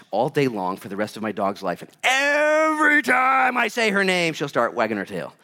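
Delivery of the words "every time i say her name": high, stretched-out mock holler; hammed-up, slow singsong shout; drawn-out shout. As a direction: belt it out high and hold the first words, sliding down. Preacher hams up calling dog's name.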